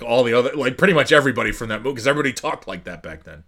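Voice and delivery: ordinary talk, nothing marked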